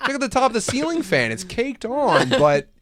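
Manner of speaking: sarcastic husband. Acted. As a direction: play the wronged, petty husband.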